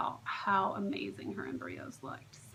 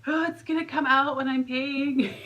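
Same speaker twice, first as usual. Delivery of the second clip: higher, louder comic panic; mock fearful; 5 semitones higher, 8 dB louder than usual. Patient retelling her greatest fear, mock panicked. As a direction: act out a silly worry with exaggerated alarm. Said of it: "my greatest fear".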